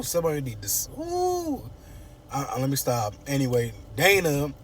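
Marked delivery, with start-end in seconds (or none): 1.0-1.6 high falsetto "ooh"; drawn-out high ooh of exasperation; exasperated hoot. Real, not acted. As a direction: hoot it high, without shouting. Exasperated at the character.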